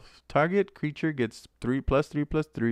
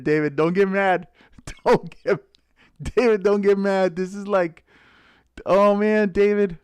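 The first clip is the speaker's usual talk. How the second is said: excited teasing; 7 semitones higher, 6 dB louder than usual. Real, not acted. Teasing David over a big pull.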